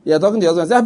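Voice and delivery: usual speaking voice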